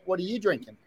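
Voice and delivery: normal talking voice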